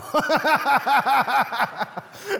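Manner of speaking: high, loud laughing fit; real burst of laughter. Real, not acted. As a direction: let the laugh roll out. Laughing at the story.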